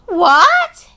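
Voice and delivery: high, loud shocked outcry; can't believe the plan. Acted.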